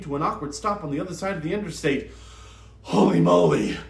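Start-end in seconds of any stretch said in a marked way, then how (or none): 2.8-3.8 low, loud gruff exclamation; a deep, dazed relief; Pep reacting after nearly crashing; stunned exclamation. A narrator's reading. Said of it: "Pep said".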